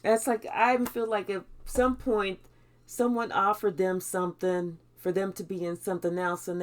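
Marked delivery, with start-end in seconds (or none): none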